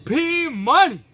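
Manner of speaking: building to a loud, high wrestling call; wrestling commentator. Acted. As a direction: call the big move like a commentator. Live play-by-play of a match.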